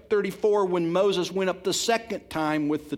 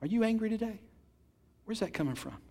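normal talking voice

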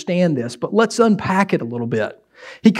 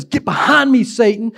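a higher, emphatic rebuke; exclaimed rebuke; 6 semitones higher than usual. Retold or imitated. Preacher re-reading Jesus' rebuke with an exclamation. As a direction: land the exclamation point. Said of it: "There's an exclamation point there in the text".

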